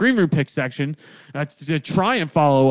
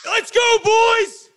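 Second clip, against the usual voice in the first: frat bro; much slower than usual. Real, not acted. Hyped sign-off shout.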